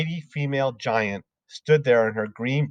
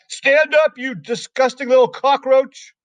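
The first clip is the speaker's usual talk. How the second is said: much higher, louder furious order; angry yell; 14 semitones higher, 6 dB louder than usual. Acted. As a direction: bark it at the child. Headmistress yelling at pupil.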